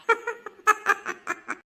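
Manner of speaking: high-pitched peals of laughter; mocking laughter. Teasing a friend scared of his crush, mocking laughter. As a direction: crack up at your friend's cowardice.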